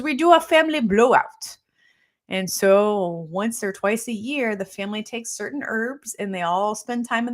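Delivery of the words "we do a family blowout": high, loud, rough imitation Jamaican accent; pretend Jamaican accent. Jamaican patient announcing the family cleanse, pretend Jamaican accent. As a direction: belt it out in an amateur Jamaican accent. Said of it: "pretend I'm talking with a Jamaican accent".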